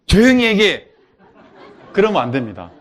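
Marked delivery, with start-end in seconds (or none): 0.1-0.9 a hint of a stern teacher, higher and louder, half laughing; mock stern command. Shown as a demonstration. 시끄러운 아이들에게 조용히 하라고 하는 선생님. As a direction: bark it like a teacher, half joking. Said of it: "긍정을 바꾼다고".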